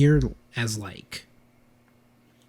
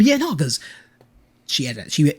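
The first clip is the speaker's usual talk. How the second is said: usual speaking voice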